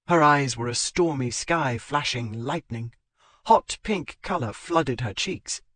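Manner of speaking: plain reading voice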